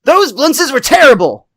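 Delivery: high-pitched comic outrage; mock-angry retort; indignant quarrel. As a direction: fire back a hammed-up complaint. Acted quarrel over payment in court.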